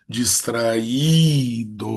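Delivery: Distraído very slow, with a long Í; Distraído drawn out on its stressed hiatus vowel. Shown as a demonstration. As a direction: stretch the Í in "Distraído". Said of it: "regra do hiato".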